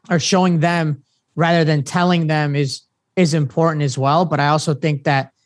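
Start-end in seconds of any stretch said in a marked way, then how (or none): none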